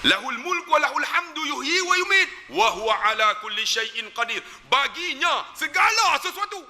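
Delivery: high, impassioned sermon voice; fervent preaching. Acted. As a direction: preach it with rising fervour. Preaching at full force. (ceramah penuh semangat dengan suara tinggi)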